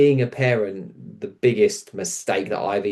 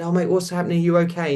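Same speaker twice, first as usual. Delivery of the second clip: higher, gentle worried questions; concerned comforting; 8 semitones higher, faster than usual. Retold or imitated. Comforting a tearful woman.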